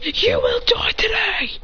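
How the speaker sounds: slow, high whispered threat; menacing whisper. Acted. Fighter threatening Hit, slow whispered menace. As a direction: breathe the threat out. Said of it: "He says".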